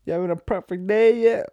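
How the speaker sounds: loud, high, slow mock boss voice. Friend mocks the boss's greeting, loud hammed-up impression. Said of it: "But hey, him".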